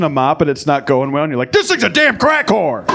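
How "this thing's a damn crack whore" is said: high, loud, slow furious yell at a mop; hammed-up angry dad.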